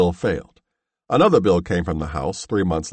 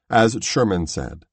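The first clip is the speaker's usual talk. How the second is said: plain reading voice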